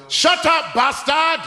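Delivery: hammed-up shout of an angry father; dad yelling. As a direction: snap it at the kid like a fed-up dad. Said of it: "My dad would look at me and go".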